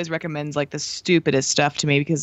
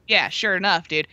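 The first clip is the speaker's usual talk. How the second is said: ordinary talk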